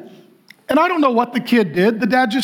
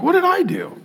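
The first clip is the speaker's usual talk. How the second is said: imitated bewildered protest; 7 semitones higher than usual. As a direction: act out a wronged child's puzzled plea. Believer protests being hit.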